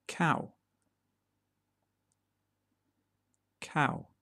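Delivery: each farm word said alone, clearly and deliberately, with long gaps; teacher modelling single words in a British accent; careful pronunciation demo. Shown as a demonstration. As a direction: model each word cleanly for learners to repeat. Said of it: "I will say each one twice to help you practice".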